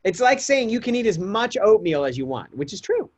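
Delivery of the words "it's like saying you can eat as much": put-on kids in disbelief. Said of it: "And they're just like".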